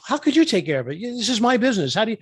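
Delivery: mildly exasperated protest, lifting in pitch; retold frustration. Upset friend objects to an offer of help, mildly exasperated. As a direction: imitate an upset friend pushing back. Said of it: "he was all upset and frustrated".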